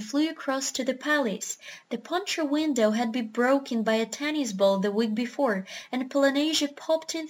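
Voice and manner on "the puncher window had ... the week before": ordinary delivery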